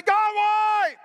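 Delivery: high, loud, drawn-out yell; screamed cry. Shown as a demonstration. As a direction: demonstrate yelling a complaint up to heaven. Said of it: "scream and yell and say".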